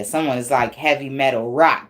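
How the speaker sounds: ordinary talk, nothing marked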